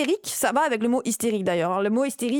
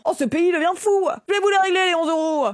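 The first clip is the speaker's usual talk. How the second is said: high, loud near-shout; mock exasperation, nearly shouting; exasperated outburst; 5 semitones higher, 4 dB louder than usual. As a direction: blurt it out, fed up and loud. Voicing the passenger paying.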